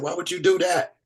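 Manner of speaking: high-pitched mock scolding question; imitating wife fussing. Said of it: "You fussed with me about that".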